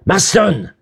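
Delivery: sharp, high-pitched summons; calling out. A narrator's reading. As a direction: call a man over urgently by surname. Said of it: "Lombard appela".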